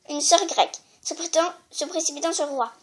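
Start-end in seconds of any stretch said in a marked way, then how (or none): none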